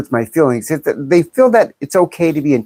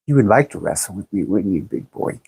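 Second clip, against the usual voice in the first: low, soft, quick flirty tease; mock-seductive murmur; teasing come-on; 8 semitones lower, 6 dB quieter than usual. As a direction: drop it low and quiet, playfully suggestive. Teasing flirty reply to chat.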